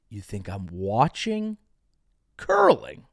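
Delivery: hushed build to a breathy curling; dramatic low then whispered. Shown as a demonstration. Host repeats his question to the audience, hushing it to a whisper. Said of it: "I've said it twice. I'll say it again".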